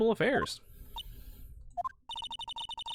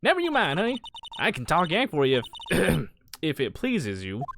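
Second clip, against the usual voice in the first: folksy, unhurried Southern gal; Southern US accent. Acted. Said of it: "so I could do this accent".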